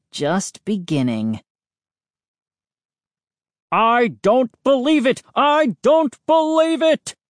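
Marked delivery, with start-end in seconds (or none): 3.7-5.2 put-on loud outraged old neighbour; angry shout. A narrator's reading. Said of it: "Mister McCall's cries". 5.3-7.2 even higher, louder outraged cry; louder shout. A narrator's reading. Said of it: "Mister McCall's cries".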